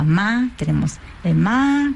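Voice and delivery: ma held level and steady; first tone ma. Shown as a demonstration. Teacher demonstrating Mandarin first tone, level. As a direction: sing ma on one flat note. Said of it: "que es la primera entonación".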